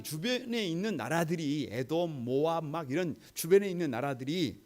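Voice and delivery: normal talking voice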